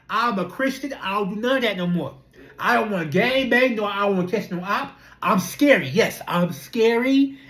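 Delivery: ordinary delivery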